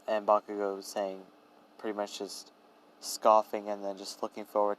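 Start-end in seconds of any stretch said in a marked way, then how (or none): none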